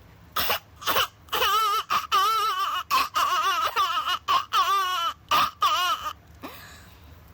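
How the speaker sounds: put-on loud high wobbly baby wail; full wailing newborn; full baby crying. Shown as a demonstration. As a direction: let the whole baby cry out. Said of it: "you put that all together".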